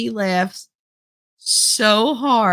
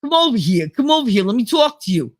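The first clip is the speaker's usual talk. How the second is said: mock Brooklyn accent; 4 semitones higher than usual. Mocking TV's idea of Jersey, exaggerated Brooklyn accent. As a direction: do a pushy Brooklyn caricature. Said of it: "That's Brooklyn".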